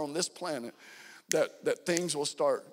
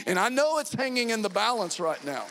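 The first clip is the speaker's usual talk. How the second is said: urgent; 5 semitones higher, 4 dB louder than usual. Real, not acted. Urgent sermon peak.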